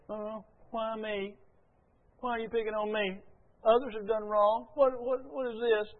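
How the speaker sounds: high, soft self-pitying whine; mock whining. Sinner trying to weasel out of consequences, mock whining. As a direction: imitate someone whining their way out of blame. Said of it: "the other course is whining".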